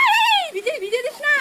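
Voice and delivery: high laughing plea; laughing protest. Real, not acted. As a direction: laugh while telling him to stop. Child spraying water, laughing.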